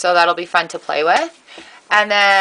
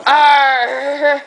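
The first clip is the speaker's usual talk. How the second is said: louder hammy pirate arr; pirate shout; 6 semitones higher, 6 dB louder than usual. Pretend. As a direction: growl it like a pirate. Talking like pirates.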